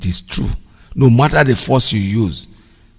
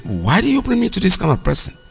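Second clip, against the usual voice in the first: high-pitched, indignant question; retold complaint, pitched well up in disbelief; indignant protest; 9 semitones higher than usual. Protesting to his friend about the scruffy stranger, indignant.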